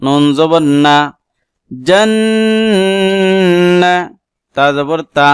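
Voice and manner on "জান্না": জান্না higher, with the doubled n hummed very long; long ghunna. Shown as a demonstration. Teacher demonstrating doubled nun, long nasal ghunna. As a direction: hold the n of জান্না through the nose. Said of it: "এই নুনে গুণনা করলাম কেন".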